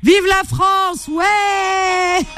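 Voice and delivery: slow stretched patriotic shout; excited cheer. Real, not acted. Celebrating the fourth goal. (acclamation traînée et enthousiaste)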